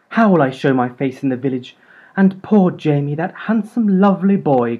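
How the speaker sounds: high, loud dramatic lament; crying out. A narrator's reading. Mum lamenting the village shame and poor Jamie, loud. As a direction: wail it to the heavens. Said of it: "cried Mum".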